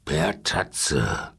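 very low lion rumble; grollender Löwe. A narrator's reading. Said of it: "grollte der Löwe". (tief grollende Löwenstimme)

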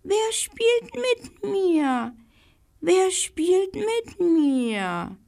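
very high, slow, delicate call; the squirrel hidden in the tree calling in a fine high voice; fine little voice. A narrator's reading. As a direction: call down from the leaves in a tiny voice. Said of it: "eine feine Stimme rief".

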